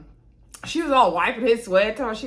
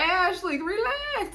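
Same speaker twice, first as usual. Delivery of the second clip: exasperated plea; 10 semitones higher, slower than usual. Retold or imitated. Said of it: "I was like".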